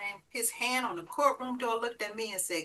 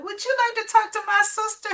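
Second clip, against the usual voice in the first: put-on child's voice; 12 semitones higher, 8 dB louder than usual. Voicing little daughter.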